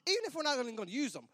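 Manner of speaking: normal talking voice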